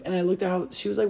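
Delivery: ordinary talk, nothing marked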